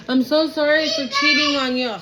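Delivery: mock tearful apology. Reader voices a cheater saying sorry to the querent, mock pleading.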